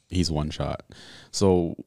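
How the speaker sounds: normal talking voice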